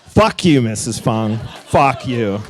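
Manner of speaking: triumphant shout of release; defiant shout. Real, not acted. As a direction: let it rip, half joking. Cathartic shout at old teacher.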